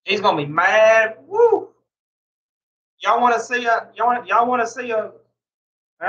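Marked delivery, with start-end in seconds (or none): 1.3-1.7 sudden high whoop of glee; a giddy woo; excited whoop. Real, not acted. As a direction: let out a gleeful high whoop. Hyping up the coming prank.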